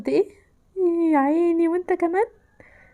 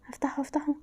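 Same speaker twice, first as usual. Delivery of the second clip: breathy whispered plea for help; 9 dB quieter than usual. Real, not acted. Gamer trapped by the clown, whispering a plea. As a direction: whisper it so he won't hear. Sneakily opening door.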